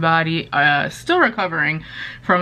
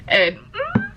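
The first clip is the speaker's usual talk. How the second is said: whimper. Real, not acted. Overwhelmed before a favourite chapter.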